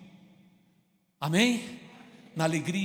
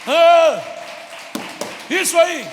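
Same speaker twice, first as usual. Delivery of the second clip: loud approving cheer; excited cheer; 7 semitones higher, 7 dB louder, slower than usual. Real, not acted. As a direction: cheer the crowd on. Cheering the answering crowd.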